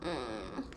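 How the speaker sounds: very low, soft pouty mm; pouting whimper. Shown as a demonstration. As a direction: pout and whimper quietly, pretending. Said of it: "because they're pouting".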